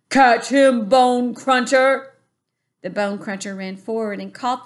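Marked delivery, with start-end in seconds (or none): 0.1-2.1 high, loud giant yell; giant shout. A narrator's reading. Giant tossing the BFG to another, a loud shout. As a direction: fling it out like a playground taunt.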